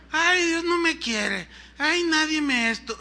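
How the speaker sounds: high, drawn-out lament; mock whining. Preacher mimicking believers who lack faith, mock whining. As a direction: imitate a self-pitying complainer.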